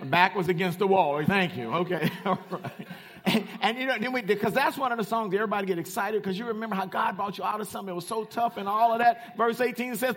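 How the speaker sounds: loud, fired-up preacher; full-force preaching. Real, not acted. Preacher testifying to the church, full-force preaching. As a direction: preach it loud and high, driving on without a breath.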